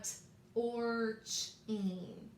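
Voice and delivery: "t, or, ch, ing" spaced sound by sound; sounded-out chunks. Shown as a demonstration. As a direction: say each chunk of "t, or, ch, ing" apart. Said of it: "a lot of hunks and chunks".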